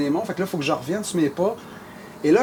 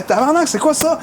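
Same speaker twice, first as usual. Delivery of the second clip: startled swear; 9 semitones higher than usual. Retold or imitated. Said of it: "je fais".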